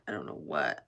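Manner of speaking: deep silly voice. Pretend. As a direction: drop into a goofy deep growl.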